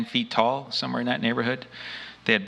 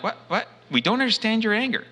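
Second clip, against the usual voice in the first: much higher, rising disbelieving echo of the alien; incredulous echo; 12 semitones higher than usual. Retold or imitated. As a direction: repeat it back, stunned it can be true.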